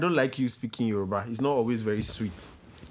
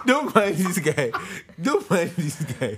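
laughing dismissal; 5 semitones higher, slower than usual. Real, not acted. Laughing at guest.

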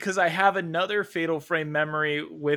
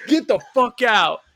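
higher, louder retold yell; mock angry shout; 8 semitones higher, 7 dB louder than usual. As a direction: bark him out of the house. Stepdad yelling at stranger.

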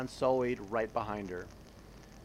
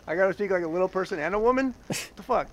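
mock outrage; 6 semitones higher, 5 dB louder, much faster than usual. Real, not acted. Reacting to role instructions.

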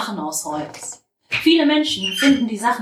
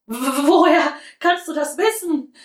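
higher, louder, stumbling over the first word; excited stammer; 8 semitones higher, 4 dB louder than usual. A narrator's reading. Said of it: "stammelt Käthe ganz aufgeregt". (aufgeregt stammeln, höher und lauter)

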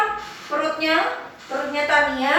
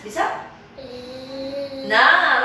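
ordinary talk

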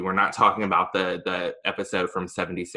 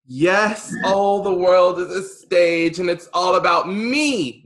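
slow theatrical villain menace; 9 semitones higher, 6 dB louder than usual. Acted. Hosting his own podcast.

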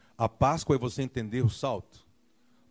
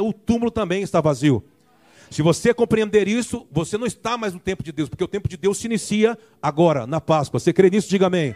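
loud, rousing sermon delivery, raised pitch; full-force preaching; 3 semitones higher, 5 dB louder than usual. Acted. Pastor rallying the congregation, full-force preaching. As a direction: preach it out, stirring the church.

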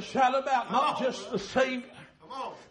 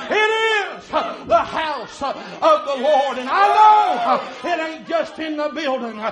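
louder, driving preaching; full-force preaching; 4 semitones higher, 8 dB louder than usual. Real, not acted. Preacher to congregation, praising the sanctuary in a rising shout. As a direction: build it up to a shout.

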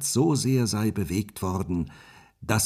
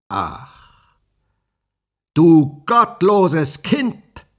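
slow, much louder and higher furious cry; a sorceress exploding in outrage; witch crying out; 9 semitones higher, 11 dB louder, much slower than usual. Acted. The sorceress scolds Rapunzel, a slow furious shriek. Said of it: "rief die Zauberin".

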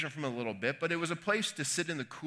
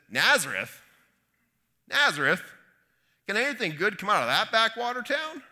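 loud, scoffing disbelief, climbing high at the end; highly skeptical; 7 semitones higher, 6 dB louder than usual. Acted. Nathanael answering Philip about Jesus' hometown, highly skeptical. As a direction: scoff at the very idea. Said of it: "highly skeptical of what he's hearing".

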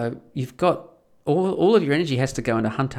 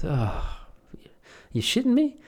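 whispered oh, then a higher disbelieving question; stunned farmer; 8 semitones higher than usual. Acted. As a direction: react in shocked disbelief. Farmer reacting to tithe.

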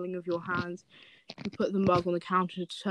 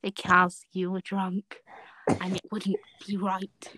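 gentle. Shown as a demonstration. Lynn gently explaining to the drunk reader, gentle. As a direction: ease off into a whisper, tender. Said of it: "His voice gentle, sorry, gentle".

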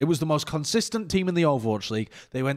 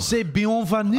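mock French accent; 8 semitones higher, 3 dB louder, slower than usual. Mock French banter opening.

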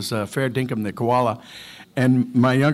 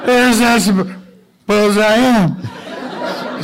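put-on very slow, higher, louder drunk drawl; town drunk; 9 semitones higher, 6 dB louder, much slower than usual. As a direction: play Otis, the town drunk. [name] answers as the town drunk.

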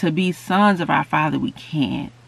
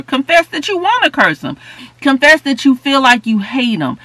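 fervent preaching shout; 10 semitones higher, 6 dB louder than usual. Real, not acted. Preaching climax, raised voice.

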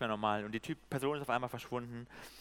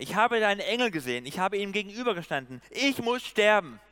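loud, high, dramatic outcry; crying out; 6 semitones higher, 7 dB louder than usual. A narrator's reading. Preacher reads Gideon fearing death after seeing the angel, crying out. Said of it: "rief er".

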